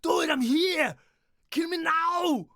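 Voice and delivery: Arnold in Predator. Retold or imitated. Retelling the Predator speech as an Arnold yell. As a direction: bellow it over and over. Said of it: "he's like".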